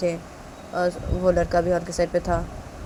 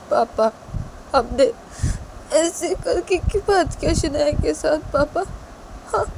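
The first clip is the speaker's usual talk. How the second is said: higher, tearful pleading son; crying son; 10 semitones higher than usual. Acted. Son asking crying father why he sent Shanaya away, tearful. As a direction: beg his father for an answer.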